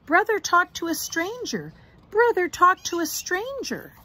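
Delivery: high, loud tattling call; shouting. Acted. Sister running home to tell on brother, shouting. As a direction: call it out across the yard. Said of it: "Sister ran home shouting".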